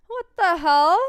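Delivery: loud, high what the hell; loud exclamation. Real, not acted. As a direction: shout it out in disbelief. Repeats the exclamation louder.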